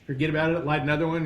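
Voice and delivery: ordinary talk, nothing marked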